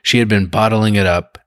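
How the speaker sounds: ordinary delivery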